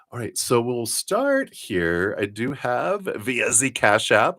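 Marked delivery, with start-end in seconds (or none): 2.2-4.4 German accent. Real, not acted. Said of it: "I go into, like, a German".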